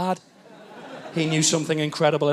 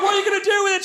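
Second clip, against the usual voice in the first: panicked; 13 semitones higher, much faster than usual. Retold or imitated. Panicked shout.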